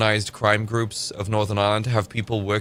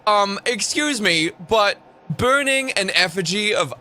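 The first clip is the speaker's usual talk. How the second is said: high, prissy mock scolding; sarcastic high voice sneering at offended critics; mock outrage; 7 semitones higher than usual. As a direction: parody a pearl-clutching scold, pitched way up. Mocking a scolding leftist.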